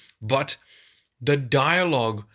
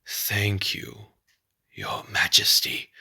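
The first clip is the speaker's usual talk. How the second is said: Scar; 2 semitones lower, slower than usual. Retold or imitated. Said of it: "cowardly Scar".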